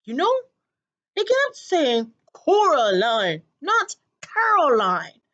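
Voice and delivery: put-on loud high odd old man; eccentric old man. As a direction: ramble loudly like a crazy old man. Old man's voice.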